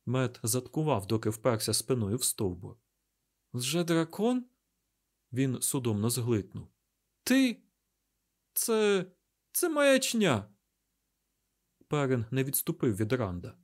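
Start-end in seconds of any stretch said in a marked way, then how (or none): 3.5-4.4 high rising question; a touch of incredulous alarm; shocked disbelief. A narrator's reading. Mat backs away from Rand, stunned disbelief. 7.2-10.5 high, halting, stunned disbelief; shocked denial. A narrator's reading. Mat backing away from his friend Rand, alarmed disbelief.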